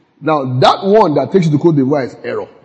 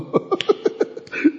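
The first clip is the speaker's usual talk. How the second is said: breathy airy laugh; 8 dB quieter than usual. Real, not acted. Laughing at the review story.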